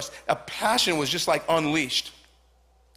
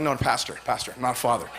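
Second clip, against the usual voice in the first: deep correcting voice; 8 semitones lower than usual. Retold or imitated. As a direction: lower the voice to correct him gently. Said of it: "And I'm like".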